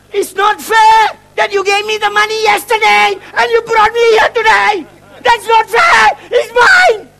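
high, loud wail of unfairness; indignant protest. Retold or imitated. Complaining to God about the money, indignant shouted protest. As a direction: cry out like a cheated cartoon duck. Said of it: "That's what I said to God".

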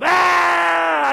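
sudden high loud yell of the demon; demon roar. Retold or imitated. Demon leaves the woman under the table, loud high roar. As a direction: let the spirit scream its way out. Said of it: "this thing roars and comes out".

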